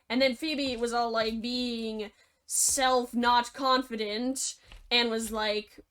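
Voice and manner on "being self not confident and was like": plain reading voice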